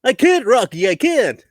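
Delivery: high goofy cartoon moose voice; Bullwinkle voice. Bullwinkle impression.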